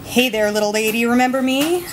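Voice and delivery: normal talking voice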